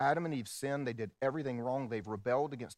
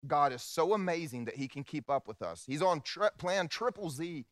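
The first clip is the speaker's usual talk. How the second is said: retold youth sermon; 5 semitones higher than usual. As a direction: replay your youthful hype sermon. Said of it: "I preached".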